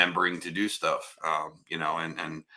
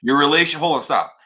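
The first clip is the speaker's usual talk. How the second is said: much louder and higher, cutting someone off; heated real objection, raised voice; loud interruption; 7 semitones higher, 10 dB louder than usual. Real, not acted. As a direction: shout them down mid-sentence.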